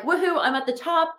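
high, hammed-up victory whoop; mock cheer. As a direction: cheer like you've made it to the top. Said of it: "And you're like".

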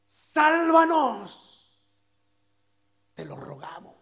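slow, drawn-out plea; earnest slow entreaty; pleading plea. Shown as a demonstration. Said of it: "El significado de Hosana es".

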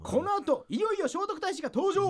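high, excited pre-commercial teaser; TV teaser announcer. Acted. Comic teases Prince Shotoku's entrance, TV announcer. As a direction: hype it up before the break. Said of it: "シーエムまたぐなよ".

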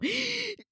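sharp high gasp of alarm; a high, sudden mock-panicked gasp; startled gasp. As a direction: gasp like you've been caught. Sees the doctor, alarmed.

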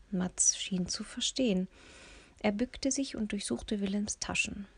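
plain reading voice

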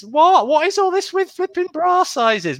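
high-pitched comic disbelief; mock outrage. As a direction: squeal it in put-on indignation.

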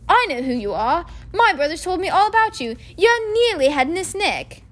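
put-on a cheeky young boy. A narrator's reading. Ron voiced.

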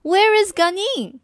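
a hint of a dad looking for his girl; lightly voiced father. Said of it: "her father said".

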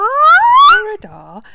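very loud, high, drawn-out greeting; playful high shout. Pretend. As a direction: call it out high and long. Adult joins the playful shouting.

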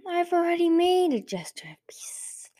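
high, breath-edged voice of feigned peace; sly villain. A narrator's reading. Blister interrupting with her peace offer, sly villain.

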